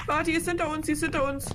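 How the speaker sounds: high alarmed cry, ghost behind; sudden fright, voice jumps up; panicked. Real, not acted. Panic, ghost right behind them.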